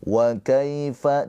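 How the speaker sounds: Wa kaifa with ya sukun said softly, short. Shown as a demonstration. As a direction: glide lightly through the ya of kaifa. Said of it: "di sini ada ya sukun".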